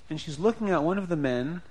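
ordinary delivery

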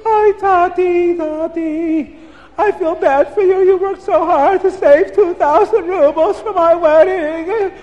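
put-on high girl's voice, tearful and pleading; a young girl consoling her father through tears; young daughter. A narrator's reading. Daughter tells her father she feels bad, high and tearful.

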